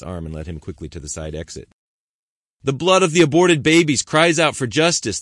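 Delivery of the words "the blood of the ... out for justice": loud, high, booming protest cry; thundering protest shout. Acted. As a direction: thunder it across the auditorium. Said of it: "the protester's strong, deep voice thundered".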